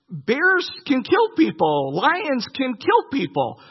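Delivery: ordinary talk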